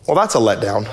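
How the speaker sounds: low, underplayed comic letdown; deadpan disappointment. John expected a lion, sees a lamb, mock disappointed. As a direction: drop the pitch for a dry anticlimax.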